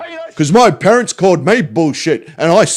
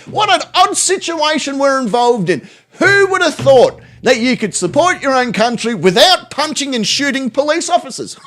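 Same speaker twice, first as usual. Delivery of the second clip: high-pitched feigned wonder; sarcastic astonishment; 7 semitones higher than usual. Acted. Streamer mocking police-hating patriots, in high feigned amazement. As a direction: marvel mockingly, well above your usual pitch.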